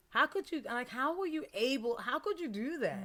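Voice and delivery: high-pitched, pleading demand to know; incredulous confrontation. Retold or imitated. Confronting her father about leaving.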